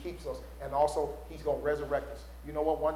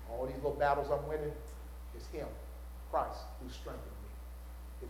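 quiet Paul; 4 semitones lower, 11 dB quieter than usual. Retold or imitated. Quoting Paul.

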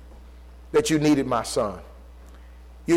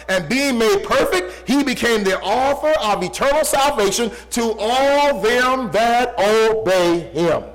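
raised, ringing pulpit voice, slowing on the verse; full-force preaching; 9 semitones higher, 4 dB louder than usual. Acted. Preacher proclaiming scripture to his congregation, full-force preaching.

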